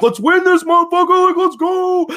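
fired-up cheering shout; hyped fan shout. Retold or imitated. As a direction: pump it up like a rowdy crowd. Hyped Dayton fans cheering.

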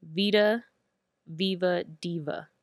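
VITA, VIVA, DIVA set apart by pauses; slow, separated words for clarity; spelled-out slowly. Shown as a demonstration. Handle repeated slowly for listeners.

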